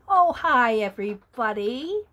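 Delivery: ordinary delivery, nothing marked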